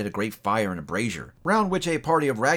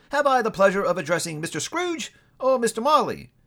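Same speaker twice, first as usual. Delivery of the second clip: courteous, lifted gentleman's voice; polite gentleman; 10 semitones higher than usual. A narrator's reading. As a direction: ask politely with a little bow in the voice. Gentleman addresses Scrooge.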